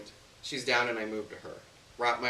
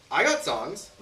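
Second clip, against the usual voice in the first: a touch louder and higher, hasty defense; mildly hurried protest; hasty, defensive; 4 semitones higher, 7 dB louder, slower than usual. A narrator's reading. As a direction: blurt it in quick self-defense. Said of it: "I say hastily".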